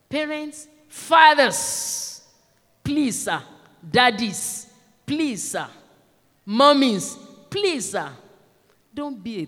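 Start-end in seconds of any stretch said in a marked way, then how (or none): 0.1-8.1 raised, spaced-out calls to the congregation; emphatic appeal. Real, not acted. Preacher begging fathers and mothers, emphatic appeal. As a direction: call out each group, then plead.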